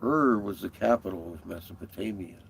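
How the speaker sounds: ordinary talk, nothing marked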